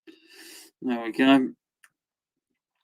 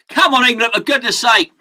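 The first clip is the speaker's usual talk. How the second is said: higher, exasperated shout at the team; 7 semitones higher, 4 dB louder than usual. Real, not acted. Football fan urging England on, shouting. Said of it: "That helps if you shout".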